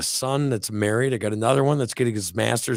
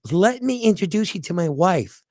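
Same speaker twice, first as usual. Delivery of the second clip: put-on handyman voice, higher and odd; imitated TV fix-it man, pitched up; Mister Fix-It impression; 5 semitones higher than usual. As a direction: mimic a TV personality's peculiar high voice. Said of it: "he talked like this all the time".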